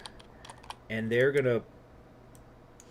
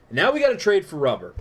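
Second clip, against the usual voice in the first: loud and high, fed up; exasperated outburst; 7 semitones higher, 9 dB louder than usual. Real, not acted. Player fed up with the rubber shortage: loud exasperated outburst. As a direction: throw up your hands at the game.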